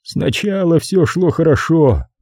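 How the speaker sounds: high, slow and sorrowful; sad, drawn-out character voice. A narrator's reading. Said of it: "сказал он грустным голосом".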